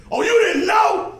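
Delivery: put-on high, shouted old-man reveal. Old man grabs the young digger, shouted reveal. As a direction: holler it loud as a wild old treasure hunter. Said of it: "An old man creeps up behind him".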